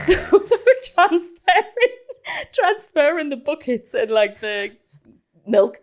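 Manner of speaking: high, loud, slowed by laughing; real laughter. Real, not acted. Storyteller revealing milkmen caused the noise, real laughter. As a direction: struggle to explain while laughing.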